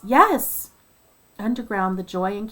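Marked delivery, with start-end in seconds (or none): 0.0-0.7 loud, high burst of joy; excited yes. Retold or imitated. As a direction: blurt it out, overjoyed. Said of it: "he's like".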